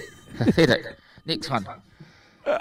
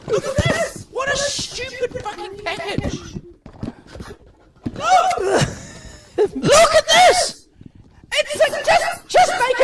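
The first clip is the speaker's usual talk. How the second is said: shrill frustrated shout, much higher; exasperated high yell; slower than usual. Real, not acted. Frustrated by excessive packaging.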